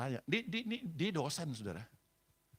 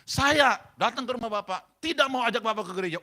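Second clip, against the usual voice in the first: high, loud re-enacted shout; barking back; 5 semitones higher, 10 dB louder than usual. Pastor snapping back at the husband who snapped, loudly. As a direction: bellow it to startle the listener. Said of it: "saya bentak lagi".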